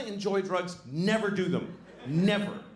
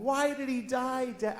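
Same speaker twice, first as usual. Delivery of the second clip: put-on high, soft, drawn-out child's question; imitating a small daughter; daughter's voice; 8 semitones higher, 5 dB quieter, slower than usual. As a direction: ask it slowly like a sad little kid. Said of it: "my daughter comes home".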